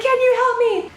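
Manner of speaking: high, loud desperate question; cling to him like a lifeline; squeaky hopeful panic; frantic high plea. Retold or imitated. Begging stranger for help at ATM.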